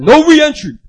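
loud, high acted bouncer bark; forceful refusal. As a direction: shout down a pleading customer. Bouncer refuses again, more forcefully.

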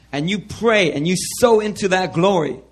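usual speaking voice

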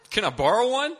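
high, loud, urgent request; startled plea. Retold or imitated. Student without a suit asking friend, alarmed. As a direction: blurt it out in alarm.

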